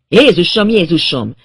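loud, startled outcry; horrified yell. A narrator's reading. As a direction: cry out in horror at the sight. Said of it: "majd akkorát ordított".

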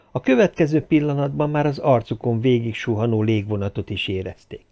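usual speaking voice

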